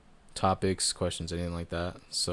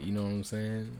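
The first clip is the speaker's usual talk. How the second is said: ordinary talk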